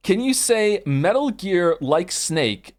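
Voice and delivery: ordinary talk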